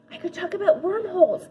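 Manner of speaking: ordinary delivery, nothing marked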